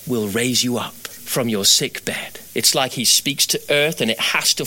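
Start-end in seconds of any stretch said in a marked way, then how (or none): 0.1-2.4 slow, low, weighty pronouncement; God speaking. Acted. God promising healing to the sick man, slow low pronouncement. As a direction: let the promise fall slowly and low. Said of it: "When God speaks".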